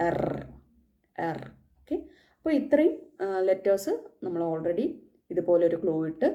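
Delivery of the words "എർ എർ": "എർ" said stressed, with a rough throaty r; "എർ" with a hard German r, unlike the silent English r. Shown as a demonstration. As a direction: roughen the r of "എർ" and lean on it. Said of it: "റഫാക്കി പറയുക".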